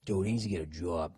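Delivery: low Boston accent. Shown as a demonstration. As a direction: round "job" into a Boston "jawb". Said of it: "working on your Boston accents".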